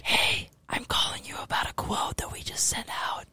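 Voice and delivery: hushed, voiceless phone whisper. Shown as a demonstration. Demonstrating whispered office phone call, whispering. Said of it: "be whispering into the phone like".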